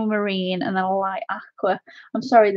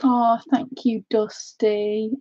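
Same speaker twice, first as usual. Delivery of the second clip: normal talking voice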